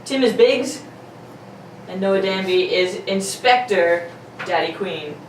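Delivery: normal talking voice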